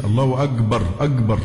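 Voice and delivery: أكبر with a hard g for the kaf, agbar; Egyptian gim sound. Shown as a demonstration. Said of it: "الجيم المصرية". (نطق كاف «أكبر» جيمًا مصرية)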